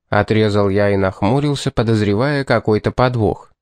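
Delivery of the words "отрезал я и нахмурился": usual speaking voice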